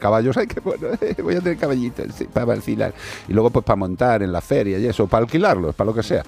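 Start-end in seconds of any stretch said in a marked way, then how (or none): 0.4-2.9 high mock voice of a smug horse owner; mocking greedy owner. Mocking the owner who wants ponies to rent. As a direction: ham up a gleeful owner, pitched up.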